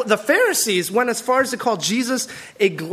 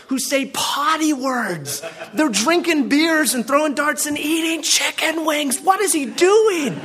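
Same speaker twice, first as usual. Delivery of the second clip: mock outrage; 6 semitones higher than usual. Pastor mocks outraged Pharisees.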